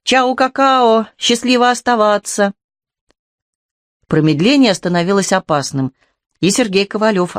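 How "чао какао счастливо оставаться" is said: high, slow sing-song farewell; cheerful exclaim. A narrator's reading. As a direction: call it out gaily and slowly. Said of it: "и воскликнула".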